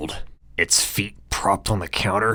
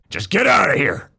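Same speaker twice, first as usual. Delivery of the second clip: high, loud hurried shout; 12 semitones higher, 6 dB louder than usual. Acted. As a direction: yell it while rushing off. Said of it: "Earl shouts as he rushes away".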